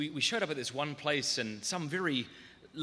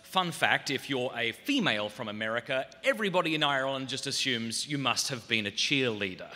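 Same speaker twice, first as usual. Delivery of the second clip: normal talking voice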